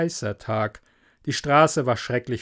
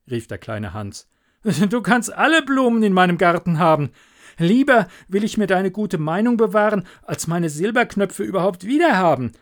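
high, loud, eager pleading; devoted little Hans; 7 semitones higher, 6 dB louder than usual. Acted. Hans to the miller, eagerly giving all his flowers. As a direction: Hans gushing to prove his friendship.

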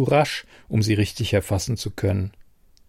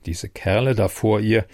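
plain reading voice

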